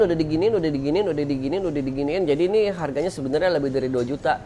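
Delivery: trailing mumble. Shown as a demonstration. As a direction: mumble the excuse away quickly. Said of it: "bisa aja lu jawab".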